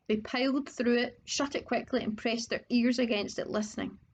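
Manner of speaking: plain reading voice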